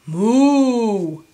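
loud, long cow moo; bellowing cow. A narrator's reading. Cow reacting to the hatched egg, loud bellow. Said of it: "bellowed the cow".

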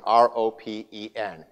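ordinary talk, nothing marked

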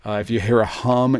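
ordinary delivery

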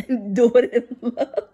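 slow, chuckling through "love"; amused self-reminder; laughing repeat. Real, not acted.

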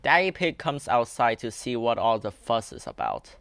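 ordinary delivery

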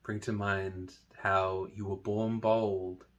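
slow, soft meditation voice with long pauses; guided meditation. Acted. Teacher leading listeners through a body meditation, slow soft guiding voice. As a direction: guide them gently through it, hushed and unhurried.

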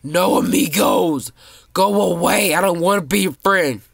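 panicked yell. Real, not acted. Kid gaming, yelling at players chasing him to go away. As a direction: shout them away.

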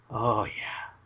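ordinary talk, nothing marked

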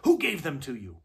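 loud demanding witch; sharp witch demand. A narrator's reading. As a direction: bark the question.

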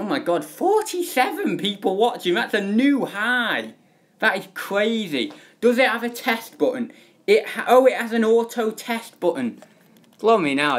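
bright rising thrill at a new high; real excitement, voice up high; excited surprise. Real, not acted. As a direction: gasp out delight at the viewer count. Record viewer count, surprise find.

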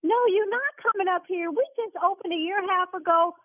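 indignant. Real, not acted. Owner refuses his threat.

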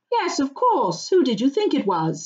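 breezy and amused; cheerful Merry. Acted. Merry greets frightened friends, lightly amused. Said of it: "said Merry".

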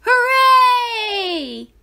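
loud high hooray; cheer. A narrator's reading. The class cheering, loud high hooray. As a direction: cheer together. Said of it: "cheered the class".

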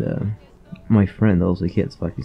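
ordinary talk, nothing marked

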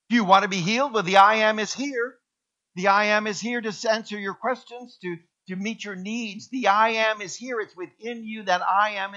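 loud, high preaching; full-force preaching. Acted. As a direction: proclaim it to the whole church.

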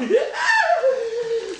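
high triumphant cackle bursting out; gleeful shrieking cackle. Acted. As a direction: cackle with delight at the mischief. Cackles at the growing puddle.

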